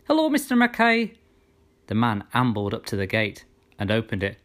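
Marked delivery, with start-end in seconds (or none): 0.1-1.1 high, loud call across to him; bright girl's shout from afar. A narrator's reading. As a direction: shout a cheery greeting. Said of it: "before shouting".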